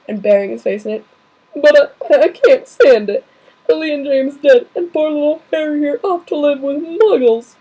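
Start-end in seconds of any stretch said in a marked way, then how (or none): 1.6-7.6 loud, higher wailing giant; bawling over the orphan; big man crying it out; sobbing. Acted. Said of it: "sobbed Hagrid".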